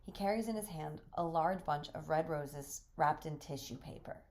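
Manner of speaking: ordinary talk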